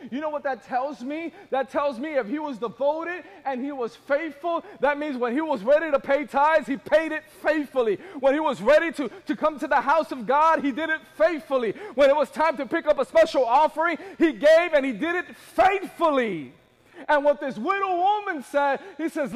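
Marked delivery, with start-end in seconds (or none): 4.8-16.5 raised, rhythmic preacher's voice; insistent preaching. Real, not acted. Preacher listing the husband's faithful deeds, insistent preaching. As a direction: drive home each repeated phrase, high and strong.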